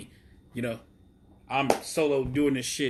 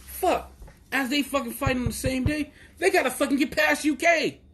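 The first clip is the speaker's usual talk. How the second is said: rising high with excitement; getting worked up about the UK brand; hyped up; 10 semitones higher than usual. Real, not acted. Fan ranting to viewers, pitch climbing excitedly. Hyping up the UK show.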